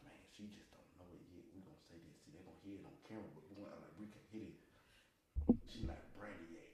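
hushed conspiratorial aside; whispered aside. Real, not acted. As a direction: keep it low so she can't hear. Hushed aside so she can't hear.

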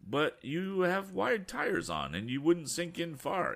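high, slow, earnest plea; pleading young inventor. Acted. Tom pleading with the farmer, high and urgent. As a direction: reason with him urgently. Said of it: "answered the young inventor".

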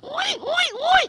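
very high pig squeal; pig oinks. Retold or imitated. Pig squeals at the wolves, very high. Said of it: "What you do with that little lamb?".

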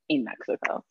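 whispered like a little secret; playful whisper. Pretend. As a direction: drop to a mock-secret whisper. Playful secret about her location.

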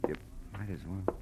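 trailing off. Acted. Trailing repeat of the urging.

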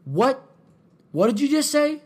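high, loud mock outrage; imitating indignant challenge. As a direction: act out an offended man squaring up. Acted angry man confronting her.

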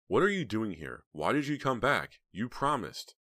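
high, indignant questioning; angry confrontation. A narrator's reading. Heather stands over Snowman demanding answers, angrily. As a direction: demand answers, sounding betrayed. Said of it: "glaring down at him".

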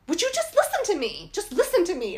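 mock irritated nurse snapping. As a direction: tell the pestering patient to listen. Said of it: "now she's getting irritated with me".

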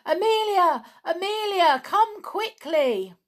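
loud, very high calling out; urgent call. A narrator's reading. Clown summons Amelia Jane from the cupboard, urgent call. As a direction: call out urgently, drawing out the last words. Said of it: "he called Amelia Jane".

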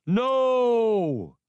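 a high, loud final scream of despair; desperate cry. A narrator's reading. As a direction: cry out as everything explodes. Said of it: "Hirkin cried a last".

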